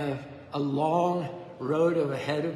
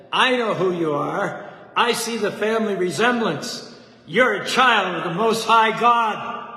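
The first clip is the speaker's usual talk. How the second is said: booming proclamation; 4 semitones higher, 8 dB louder than usual. Retold or imitated. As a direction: announce it to the whole congregation. Preacher proclaims the boy's identity.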